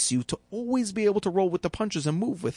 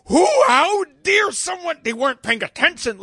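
indignant outrage; 11 semitones higher, 8 dB louder than usual. Retold or imitated. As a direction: play the offended driver, hammed up.

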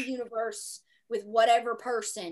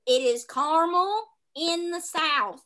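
Southern accent; 4 semitones higher, 6 dB louder, slower than usual. Shown as a demonstration. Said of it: "I am from the South".

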